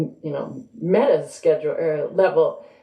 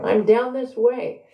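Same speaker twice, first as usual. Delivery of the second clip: calling out; 7 semitones higher than usual. A narrator's reading. Haru calls from down the hall.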